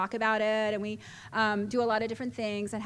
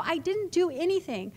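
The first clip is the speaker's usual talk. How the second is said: higher-pitched insistent denial; defensive high protest; protesting innocence; 6 semitones higher than usual. Retold or imitated. Acting her protesting younger self.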